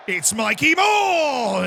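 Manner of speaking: high, slow, loud call of the name; excited shout. Real, not acted. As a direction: stretch the name out like a commentator. Calling a chance in the game.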